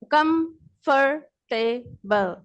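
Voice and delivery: Comfortable broken slowly into four separate chunks; split into syllables. Shown as a demonstration. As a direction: say comfortable syllable by syllable, pausing after each. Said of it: "different chunks में divide कर देते".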